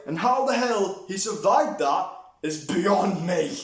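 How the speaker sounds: ordinary talk, nothing marked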